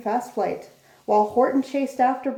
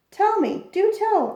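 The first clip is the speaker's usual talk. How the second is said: much higher, louder joyful cry; cried out with joy; 9 semitones higher, 4 dB louder than usual. A narrator's reading. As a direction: burst out in relief at finding them. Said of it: "cried the elephant".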